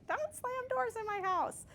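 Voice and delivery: very high, drawn-out scolding voice; imitating own mom. Speaker echoes her mom over slamming doors, mock scolding. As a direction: stretch it out like a scolding mother. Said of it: "I sound like my mom".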